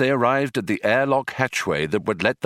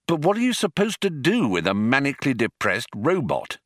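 higher, lively swinging pitch; breezy complaint; 6 semitones higher than usual. Acted. Zaphod grumbles about the robot they must take along, breezy complaint. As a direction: toss it out as an exasperated question.

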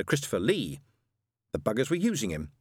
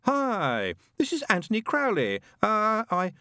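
higher, lazy recorded greeting; very laid back; 11 semitones higher, slower than usual. Acted. Demon's answering machine greets the caller, utterly laid back. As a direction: lounge through the answerphone message. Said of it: "sounded so laid back".

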